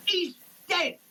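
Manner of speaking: normal talking voice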